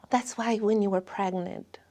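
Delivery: ordinary delivery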